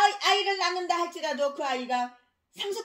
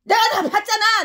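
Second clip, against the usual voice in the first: louder, higher, accusing shout; angry shout; 6 semitones higher, 9 dB louder than usual. Real, not acted. Preacher shouting at corrupt pastors, angry accusing shout. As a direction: yell it like an accusation.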